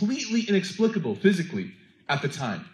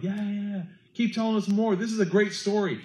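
jeering crowd; 6 semitones higher than usual. Retold or imitated.

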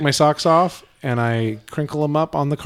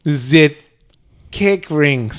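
German accent; much slower than usual. Shown as a demonstration. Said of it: "pronounce it in the German accent".

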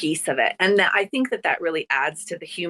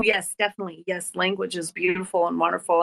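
ordinary delivery

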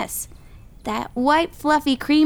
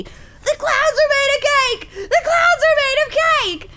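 excited yelling; 10 semitones higher than usual. Acted. Dad running down the street, excited yelling. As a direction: call it out to the whole street. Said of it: "yelling to everybody he could see".

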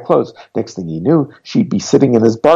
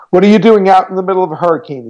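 fast, much higher, louder shout; nervous man shouting at the stranger; 9 semitones higher, 5 dB louder, faster than usual. A narrator's reading. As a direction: fire it at her through the door. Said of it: "he shouted".

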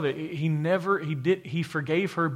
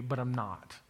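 softly; 3 semitones lower, 8 dB quieter than usual. Acted. Voicing the Lord's mercy.

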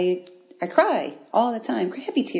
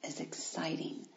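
whispered; 13 dB quieter than usual. Real, not acted. Confiding about vulnerability to listeners.

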